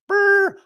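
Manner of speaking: high rolled brr like engines; rocket rumble. Pretend. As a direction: make the rocket roar.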